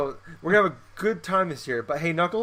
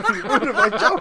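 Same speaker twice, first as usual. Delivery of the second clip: high, loud, helpless laughter through the words; laughing protest; 7 dB louder, slower than usual. Real, not acted. Joker to co-host who spoiled the bit, laughing hard. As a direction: protest while cracking up.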